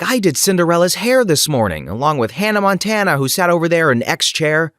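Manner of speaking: a touch of princess-salon chatter; makeover patter. Retold or imitated. Retelling her makeover patter to girls.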